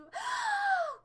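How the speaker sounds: loud, high, sudden gasp; delighted gasp. Real, not acted. Streamer spots a viewer, delighted gasp. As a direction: gasp sharply in delighted surprise.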